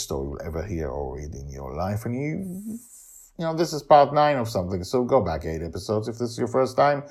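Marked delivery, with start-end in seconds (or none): none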